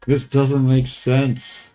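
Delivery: "This doesn't make sense" stretched slowly, each word dragged in exaggerated puzzlement; slow it way down like a baffled cartoon; drawn-out mock disbelief. Repeats own line, drawn out.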